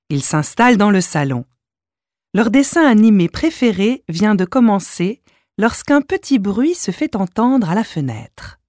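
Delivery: ordinary delivery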